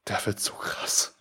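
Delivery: hushed, slowed-down whisper of disbelief; stunned whispered aside; awed whisper. Retold or imitated. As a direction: breathe it out in amazement. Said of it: "wir waren so".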